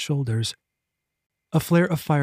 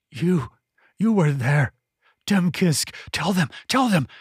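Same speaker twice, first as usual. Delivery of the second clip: rising, pleading, frantic repetition; raving madman; 4 semitones higher than usual. Acted. Madman Eeples urging Rajan to speak, rising frantic. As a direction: jab each word at the lad.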